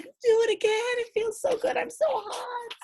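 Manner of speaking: put-on high little baby voice; squeaky delighted toddler. Baby asks the dog to splash again, high voice. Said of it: "She's like".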